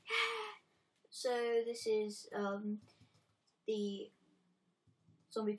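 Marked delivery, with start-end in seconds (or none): 0.1-0.5 excited cheer. Real, not acted. Cheering the title.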